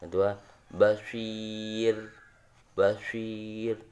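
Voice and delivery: basir slowly drawn out, light ro; teacher recites the example to eighth graders; recited example. Shown as a demonstration. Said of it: "bukan basir, tapi basir".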